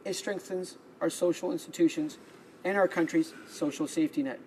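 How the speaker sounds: formal prepared speech in the House; parliament speech. Acted. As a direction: address the chamber, measured and formal. MP delivers prepared budget speech.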